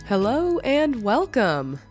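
ordinary talk, nothing marked

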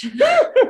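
a sudden high burst of laughter. Real, not acted. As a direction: let a real laugh break out high. Laughing at her own joke.

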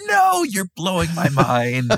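high, drawn-out disbelief; exaggerated, stretched-out astonishment; mock amazement. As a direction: ham up being blown away, slowly. Hammed-up amazement at the list.

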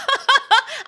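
high, loud burst of laughter; big laugh. Real, not acted. Laughs at suiting little kids. (高声大笑)

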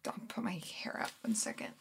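whisper. Acted. Whispering aside while fixing hair.

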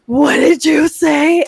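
put-on drawn-out shocked challenge to a rude child; shocked slow demand. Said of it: "I was like".

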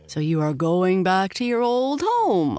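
high voice climbing to a peak; playful storybook monkey, rising high; monkey character. A narrator's reading. Monkey to departing pony friend, high monkey voice. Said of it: "observed the monkey".

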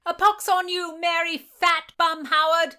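high, loud mock insult; playful curse. A narrator's reading. Bridget shouts at Mary after the snowball, loud and high. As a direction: throw the insult back laughing.

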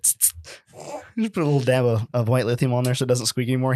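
ordinary talk, nothing marked

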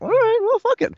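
very high, loud comic squeal; mock carefree giving-up. Actor shrugging off his poor accent, mock carefree giving-up. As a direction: toss it out in a silly high voice. Acts out giving up and going ahead.

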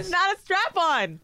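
high, slow exasperated correction; correcting Akash, incredulous. Real, not acted. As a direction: set him straight, incredulous. Correcting co-host.

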